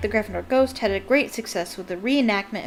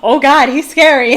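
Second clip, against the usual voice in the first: put-on much louder, higher panicked kid cry; scared kid; 5 semitones higher, 15 dB louder than usual. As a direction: yelp like a frightened child. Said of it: "it's like".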